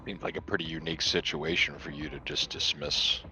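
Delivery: normal talking voice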